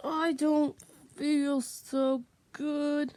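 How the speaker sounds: loud, drawn-out, slow complaint. Shown as a demonstration. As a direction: call it out slowly and loudly. Loud slow take, repeated line.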